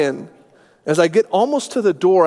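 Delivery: ordinary delivery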